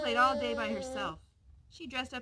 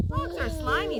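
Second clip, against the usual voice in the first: disgusted; 6 semitones higher, 9 dB louder than usual. A narrator's reading. Girl complains about frogs.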